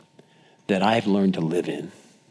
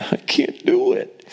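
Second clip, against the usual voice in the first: high, squeaky, drawn-out mock wail; mock panic. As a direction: chicken out in a tiny, slow falsetto. Balking on the diving board edge.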